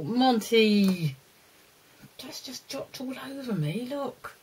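ordinary talk

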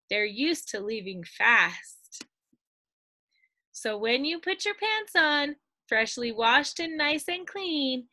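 lively, sing-song storybook voice; bouncy read-aloud storytelling; reading to children. Acted. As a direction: read it out to a class of little ones. Reads picture book to children.

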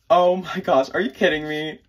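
thrilled and disbelieving; gasping surprise. Real, not acted. Unboxing a tiny CD for her viewers, gasping in surprise.

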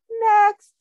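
high, loud counter call; calling next. Acted. Pizza maker calling the waiting line, loud high call. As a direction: bark it down the line. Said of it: "the pizziello is saying".

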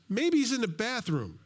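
high, lilting jeer; Elijah's mocking taunt. Elijah poking fun at Baal's prophets, mocking taunt. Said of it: "starts poking fun at him".

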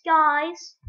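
normal talking voice

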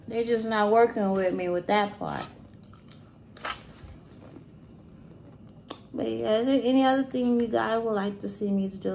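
talking between bites; chewing while talking. Real, not acted. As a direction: mouth partly full of food. Seen 3: fork of food in hand, hand to mouth, jaw moving between words.

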